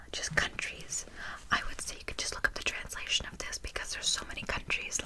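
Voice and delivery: hushed ASMR whisper. Acted. Tutor advising student on looking up countries, whispered. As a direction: whisper the advice softly. Soft-spoken lesson drops into whisper.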